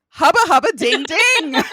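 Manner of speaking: loud, high, slow hammed-up catcall; mock swooning. Swooning over his old photo, hammed-up loud catcall. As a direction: ogle him out loud like a cartoon wolf. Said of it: "and I was like".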